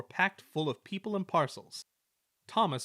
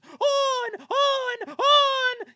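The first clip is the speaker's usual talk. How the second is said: much higher, loud wild yells; yelling; 10 dB louder, much slower than usual. Acted. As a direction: trucks shoving him downhill, yelling. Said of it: "yelled the trucks".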